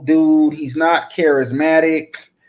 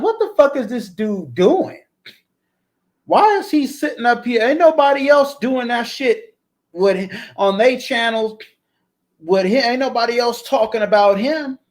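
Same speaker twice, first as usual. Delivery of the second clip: higher-pitched, baffled questioning; incredulous reaction; 7 semitones higher than usual. Retold or imitated. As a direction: retell it in exasperated disbelief, pitched up. Retelling his bewildered reaction.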